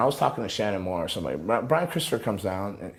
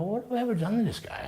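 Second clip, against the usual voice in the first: baffled; 13 semitones higher, 4 dB quieter than usual. Retold or imitated. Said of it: "I go".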